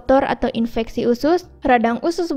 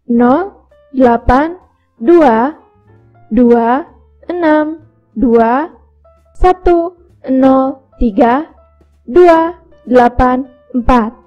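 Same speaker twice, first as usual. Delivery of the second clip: slow dictation; much slower than usual. Acted. Dictating a phone number.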